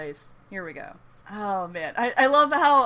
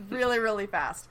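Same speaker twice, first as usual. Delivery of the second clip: ordinary talk, nothing marked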